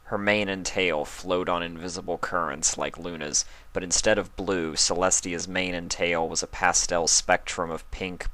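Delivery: ordinary delivery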